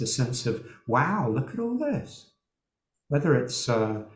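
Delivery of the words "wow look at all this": high-pitched voiced wow of wonder; wondering interest. Meditation guide voicing the interest that might arise, acted wonder. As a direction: act out sudden fascination, pitch leaping up. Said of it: "we might find interest will arise".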